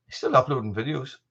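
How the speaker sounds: usual speaking voice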